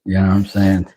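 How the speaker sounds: normal talking voice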